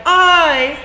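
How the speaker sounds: high loud nasal whine. Shown as a demonstration.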